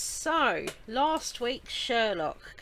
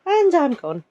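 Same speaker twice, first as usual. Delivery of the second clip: cute pet voice; 14 dB louder than usual. Pretend. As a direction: sign off as the hamster. Hamster voice signing off.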